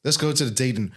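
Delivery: ordinary talk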